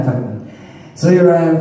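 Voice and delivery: usual speaking voice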